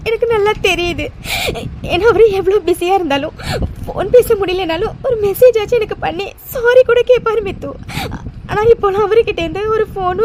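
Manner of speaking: sobbing words out to a friend; crying to her friend about Arjun. Acted. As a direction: let the tears break each phrase. Holds crying throughout.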